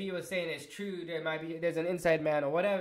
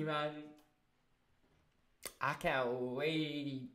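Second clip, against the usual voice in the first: normal talking voice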